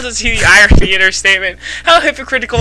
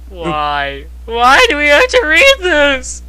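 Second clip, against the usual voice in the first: high-pitched plaintive wail; high, put-upon whining; despairing whine; 6 semitones higher, slower than usual. Real, not acted. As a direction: lament it in comic despair. Lamenting the awful story.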